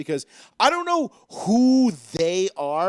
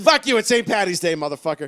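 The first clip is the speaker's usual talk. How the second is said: mock outburst; 6 dB louder than usual. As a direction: bark it like a rowdy fan on Saint Patty's Day. Playful outburst at Canadian joke.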